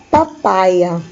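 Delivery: normal talking voice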